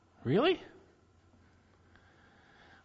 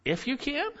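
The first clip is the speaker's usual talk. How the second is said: rising, much higher disbelieving "If you can?"; incredulous echo; 10 semitones higher than usual. Acted. Jesus echoing the father's doubt, incredulous. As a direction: echo the doubt back as a question. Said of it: "He reproves the dead".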